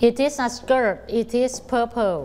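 very slow, clear reading aloud; slow model reading. Shown as a demonstration. Teacher reading sentences for pupils to practise, very slow. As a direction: stretch every word for the kids. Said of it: "ต้องช่วยกันฝึกอ่านนะคะ".

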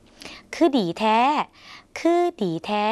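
"คือดีแท้" stretched very slowly, Lao syllable by syllable, said twice; very slow Lao demo, said twice; slow Lao phrase. Shown as a demonstration. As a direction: model "คือดีแท้" at a crawl for learners. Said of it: "คุณผู้ชมลองฝึกออกเสียงภาษาลาว".

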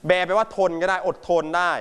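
ordinary talk

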